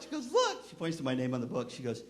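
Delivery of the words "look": put-on very high little-girl squeal; excited child. Grandniece shows her uncle his name on the book, excited. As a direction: show it off like an eager child.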